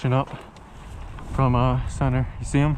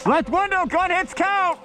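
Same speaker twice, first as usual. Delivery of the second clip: shouted callout; slower than usual. Real, not acted. Calling out an enemy gun.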